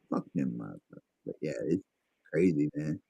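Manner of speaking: a low grumbling aside to a friend; muttered aside. Real, not acted. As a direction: mutter it to yourself, deep and low. Muttering aside, voice drops away.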